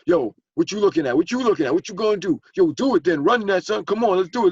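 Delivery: loud, high street tough picking a fight; wolf-ticket tough guy. Retold or imitated. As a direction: bark out the challenge like a bluffing tough guy. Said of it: "the selling wolf tickets".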